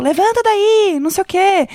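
mock a woman shouting an order; imitated yelling. Retelling a woman yelling at a homeless man, imitated shout. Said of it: "Era ela gritando pro mendigo".

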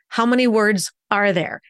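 ordinary talk

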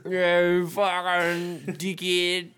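high, drawn-out mock snarl; mock insult. Answering the threat of a bop on the nose, high and very slow. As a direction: stretch the insult out in a put-on voice.

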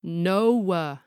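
put-on "No" stretched like a whining kid's "no-oo-wuh"; tortured triphthong. Kid refusing a parent, tortured triphthong. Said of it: "tortured into a whole triphthong".

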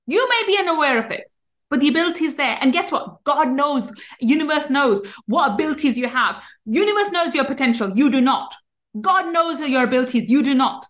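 ordinary talk, nothing marked

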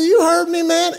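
retold tough-guy taunt. Player taunting Bart Scott face to face, a cocky high-pitched retort. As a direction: bluff him like you mean it.

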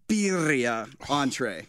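slow birria with a trilled r; rolled r. Real, not acted. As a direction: roll the r in birria entree. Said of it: "you gotta say it with a tongue roll".

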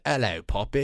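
tipsy British pirate greeting; put-on British accent, lilting up at the end; Captain Sparrow. Co-host mimicking the movie pirate to tease friend, British accent. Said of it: "you almost sound like you're gonna do".

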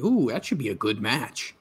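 ordinary talk

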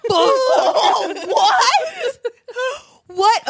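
high, laughing disbelief; real laughing shock; shocked laughing what. Real, not acted. Shocked at sibling count.

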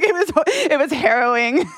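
high, hammed-up dramatic rich friend; imitating a rich friend calling panhandling harrowing, high and hammed-up; mock dramatic. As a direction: overplay the drama, laughing at him. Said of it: "He was like".

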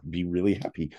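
ordinary talk

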